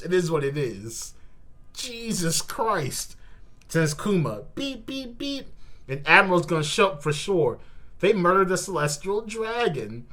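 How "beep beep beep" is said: soft high mock beeps; robot beeping. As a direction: beep like a machine, quietly. Imitating a robot sound.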